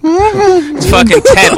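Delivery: high, slow words through laughter; laughing. Real, not acted. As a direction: squeeze it out while laughing.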